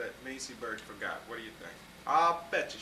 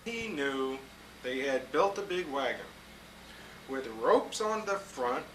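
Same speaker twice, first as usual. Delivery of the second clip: put-on lively read-aloud to children; storybook reading; 5 semitones higher, 4 dB louder than usual. A narrator's reading. Grown-up reading a picture book to kids, lively storytelling.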